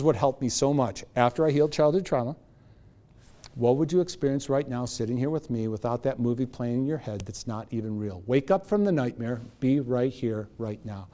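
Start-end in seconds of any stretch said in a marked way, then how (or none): 3.5-11.1 soft, low, soothing coach voice; calm guiding. Retold or imitated. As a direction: gently guide them back into the present. Coach guides girl back to present.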